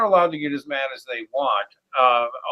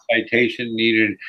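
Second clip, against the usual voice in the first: drawn-out, slow citation needed; slow sarcastic repetition; slow mocking drone. Shown as a demonstration. Mocking repeated citation demand.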